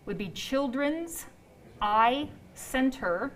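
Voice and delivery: slow dictation with long pauses; deliberately slow. Real, not acted. Host reading out the clinic website, deliberately slow. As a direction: give each word room, very slowly. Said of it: "I'm just saying it slow".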